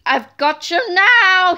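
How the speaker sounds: slow, loud gloating villain voice; villain trousers catching the boys; villain shout. A narrator's reading. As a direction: savour the triumph. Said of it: "he shouted".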